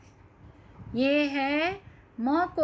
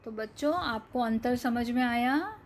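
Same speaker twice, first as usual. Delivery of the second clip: ordinary talk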